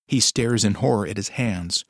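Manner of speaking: plain reading voice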